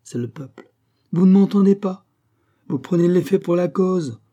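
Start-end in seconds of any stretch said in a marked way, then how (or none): 1.1-4.2 sharply raised, higher and louder; forceful rebuke. Acted. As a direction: cut him off and drive the point home. Cagliostro rebukes Gilbert forcefully. (reproche véhément, plus aigu et plus fort)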